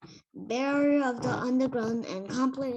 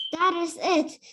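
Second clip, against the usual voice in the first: voicing Hagrid; 6 semitones higher, 6 dB louder than usual. Pupil reading Hagrid's announcement, higher and louder. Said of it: "said Hagrid".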